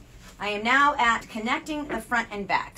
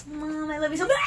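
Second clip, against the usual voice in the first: voicing the dog; 7 semitones higher than usual. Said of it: "this jerk just was like".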